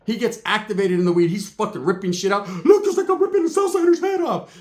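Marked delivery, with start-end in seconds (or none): 2.6-4.6 very high, manic character voice; hyped-up Ricky. Retold or imitated. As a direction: squeal it like an over-excited gangster. Hyped Ricky ripping plants out.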